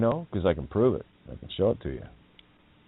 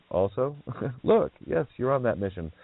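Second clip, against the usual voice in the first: ordinary delivery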